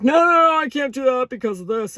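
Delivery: loud, high, frantic no no no; imitating people balking at a big leap; mock panicked protest. People refusing to quit their job, loud mock panic. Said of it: "They're like".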